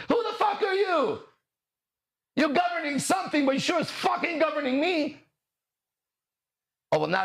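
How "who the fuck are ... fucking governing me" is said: angry shout. Real, not acted. Ranting at whoever governs him, an angry shout. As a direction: let it burst out, furious.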